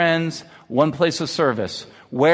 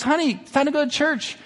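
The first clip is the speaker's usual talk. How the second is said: a touch of persistent mom, pitched up; lightly voiced mother; 11 semitones higher than usual. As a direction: insist as a mother in a joke. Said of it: "She goes".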